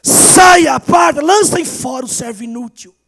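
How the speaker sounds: loud, high shouted banishment; thundering rebuke. Retold or imitated. Jesus condemning the useless servant, shouted rebuke. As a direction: cast the servant out with full force. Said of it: "Jesus fala assim".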